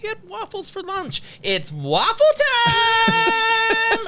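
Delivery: very high, loud, slow cheerful announcement; sing-song parent. Retold or imitated. Dad hyping waffles to his daughter, high sing-song. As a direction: sell waffle time like a party. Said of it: "I'm like".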